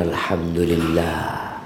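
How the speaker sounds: prayer recitation. Acted. Reciting after-meal prayer.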